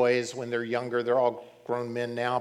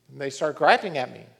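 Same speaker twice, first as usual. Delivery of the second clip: ordinary talk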